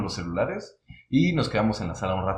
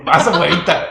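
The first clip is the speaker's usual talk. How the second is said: laughing, pitched up and louder; 6 semitones higher, 9 dB louder than usual. Real, not acted. Mocking his friend's escape plan, laughing. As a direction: tease him through a laugh.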